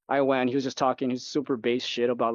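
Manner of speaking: ordinary talk, nothing marked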